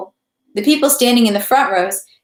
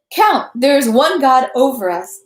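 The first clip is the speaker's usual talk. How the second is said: raised, higher-pitched appeal; timid yet theatrical; 4 semitones higher, 4 dB louder than usual. A narrator's reading. Prisoner appealing to the count, timid but theatrical. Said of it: "timid at the, and at the same time theatrical".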